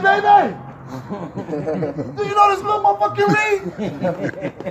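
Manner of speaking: shouting the news through laughter; amazed call. Retold or imitated. Calling wife, amazed son reads.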